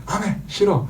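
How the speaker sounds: put-on small child saying no; defiant toddler. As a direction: toss it off like a stubborn child. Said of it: "그 본질을 갖고 태어났기 때문에".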